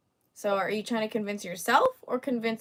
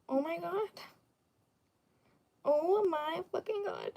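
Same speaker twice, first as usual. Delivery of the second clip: mock despair; 7 semitones higher, 6 dB quieter than usual. Mock despair at weird-looking remark.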